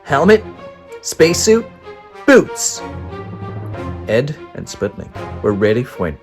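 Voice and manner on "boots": ordinary delivery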